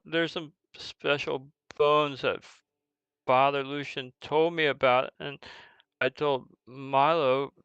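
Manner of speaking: stammering, lightly voiced kid; upset orphan child. Orphan explaining that his friend took the bones, distressed. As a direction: confess in halting bursts, then defend him.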